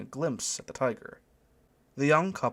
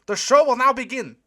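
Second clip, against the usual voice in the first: put-on high, loud German-accented proclamation; screamed announcement; 14 semitones higher, 10 dB louder than usual. A narrator's reading. German usher opening the show, high loud announcement. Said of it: "screamed the German from the doorway".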